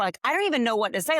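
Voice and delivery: ordinary talk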